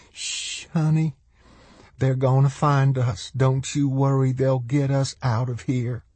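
high-voiced, gentle reassurance; soothing mother. A narrator's reading. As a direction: comfort a crying child in a high voice. Mother comforts her crying daughter.

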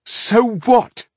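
high, loud, defiant snap; dark defiance. A narrator's reading. Coira brushing off Millennia's plea, sharply defiant. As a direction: throw the objection straight back. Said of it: "Coira's countenance darkened".

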